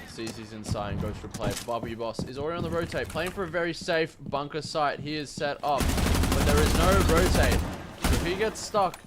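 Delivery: ordinary delivery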